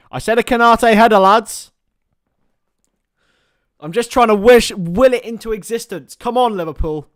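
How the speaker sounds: loud, high hopeful cheer; will the goal into existence; excited pleading. Real, not acted. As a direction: fan shouting at his mates. Willing a goal from the corner.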